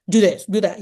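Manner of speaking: loud, authoritative mock command; mock bossy teacher giving orders. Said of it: "a teacher would sound bossy and authoritative".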